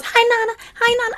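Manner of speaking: very high, bright "Hi, Nana" twice; excited little niece. Retold or imitated. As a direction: bounce it out like an eager toddler. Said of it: "So when she goes".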